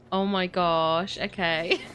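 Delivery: ordinary talk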